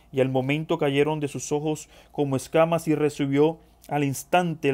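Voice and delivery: projected, continuous reading voice; scripture reading. Acted. Preacher reading the Ananías story to the brethren, scripture reading. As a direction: read the passage out firmly to the congregation. Reads the Ananías passage aloud.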